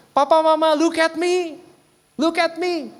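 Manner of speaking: put-on high, loud child's call. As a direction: call out like a brave little girl being injected. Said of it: "dia teriak sama kami". (seruan anak kecil yang keras dan tinggi)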